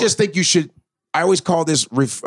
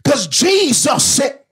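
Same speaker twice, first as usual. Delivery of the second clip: loud, higher, slowing preacher shout; hacky preacher voice; 10 semitones higher, 4 dB louder than usual. Shown as a demonstration. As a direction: preach it like a hack pastor.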